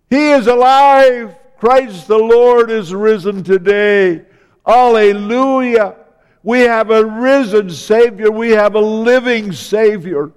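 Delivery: loud, fervent Easter preaching; rousing full-voiced pulpit proclamation; preaching at full force. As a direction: build the congregation up with joyful fire. Easter climax of sermon.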